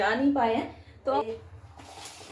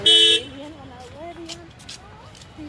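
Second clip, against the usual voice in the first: excited pointing out; 8 semitones higher, 7 dB louder, slower than usual. Real, not acted. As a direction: call it out slowly, high and loud. Pointing out alien to child.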